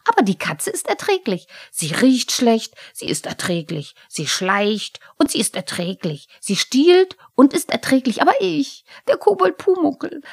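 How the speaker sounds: high, loud, furious kobold tirade; squeaky, wounded, storming outburst; outraged kobold. A narrator's reading. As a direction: rant with mounting hurt pride.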